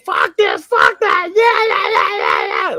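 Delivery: very high screechy bird voice; screaming cockatiel. Retold or imitated. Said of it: "They'll scream all day".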